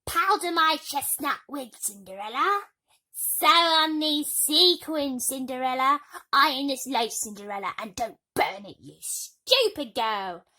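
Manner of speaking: high, loud, demanding orders; spoiled sisters barking chores at Cinderella; bossy stepsisters. A narrator's reading. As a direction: order her about like a servant. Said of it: "They talked of nothing else".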